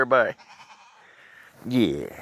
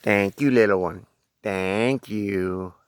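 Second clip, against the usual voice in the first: normal talking voice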